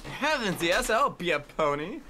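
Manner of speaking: playful Scottish impression, an eager yes drawn out; imitated Scottish friend. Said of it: "Nixie's Scottish now".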